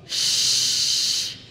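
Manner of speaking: Shh hissed softly with air spread across the mouth; shin hiss. Shown as a demonstration. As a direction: blow a soft spreading Shh. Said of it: "itu adalah makhraj huruf shin".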